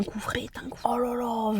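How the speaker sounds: whispered aside; whispering. Real, not acted. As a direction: breathe out a hushed aside. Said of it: "unvoiced whisper".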